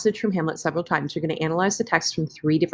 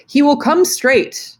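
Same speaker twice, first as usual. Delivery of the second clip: slow, higher and louder stage reading of Polonius; Polonius quote; 8 semitones higher, 5 dB louder, slower than usual. Retold or imitated. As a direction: read it out slowly like an actor on stage. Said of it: "Polonius says".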